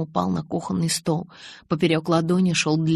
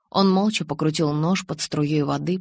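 usual speaking voice

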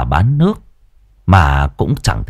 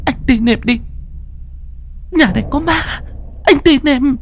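much higher, fast, near-crying plea; tearful pleading wife; 4 dB quieter than usual. A narrator's reading. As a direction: beg to be taken outside, on the edge of tears. Wife insists. (giọng vợ van xin, mếu máo)

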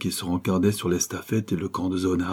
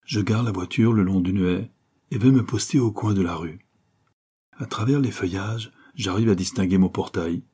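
ordinary delivery

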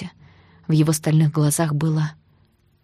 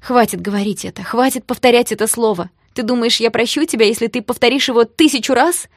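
loud, high, angry protest; building, indignant outburst; exclaimed; 5 semitones higher, 5 dB louder than usual. A narrator's reading. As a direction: raise your voice, fed up and hurt. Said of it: "воскликнула я".

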